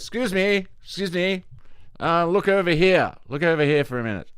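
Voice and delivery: high-pitched farmer calling out; distracting farmer. Farmer comes out to distract the cows, high calling voice. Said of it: "the farmer just comes out and goes".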